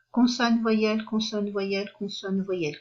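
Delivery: usual speaking voice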